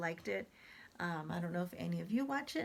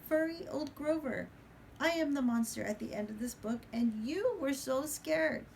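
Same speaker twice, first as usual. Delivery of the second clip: high, loud, gleeful puppet monster; proud Grover; 5 semitones higher, 3 dB louder than usual. Acted. Grover revealing he is the monster, high and gleeful. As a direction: crow with delight at the reveal. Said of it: "Grover is very exaggerated".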